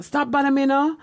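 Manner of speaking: lightly voiced Jamaican mother; a hint of a Jamaican mother shooing a child away; mother's Jamaican accent. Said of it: "in your Jamaican".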